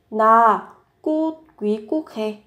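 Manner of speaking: teaching read broken by clear pauses; word by word. Shown as a demonstration. Teacher models the Korean sentence for learners, word by word.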